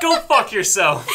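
loud, high laughing outburst; laughing shout. Real, not acted. Outraged laughing retort.

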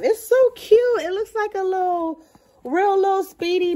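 thrilled high gush; excited gush. Real, not acted. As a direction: squeal over how cute it is. Gushing over bag interior.

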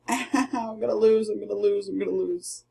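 fading from a laugh to quiet; giving up with a chuckle, fading out; laughing defeat. Real, not acted. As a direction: laugh it off, trailing away. Losing the fight, laughing.

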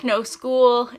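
normal talking voice

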